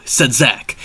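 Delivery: usual speaking voice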